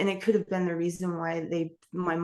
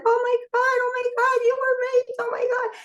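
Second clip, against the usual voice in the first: very high, loud panicked grandma, imitating her; hammed-up grandma in a high panic; 5 dB louder than usual. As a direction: shriek like a frantic grandmother. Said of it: "And she's like".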